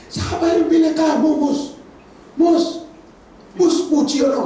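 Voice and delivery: loud, high jeering question; angry demand. Acted. The crowd demanding who chose Moses, loud and jeering. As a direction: shout the challenge at Moses. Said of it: "mereka nanya sekarang".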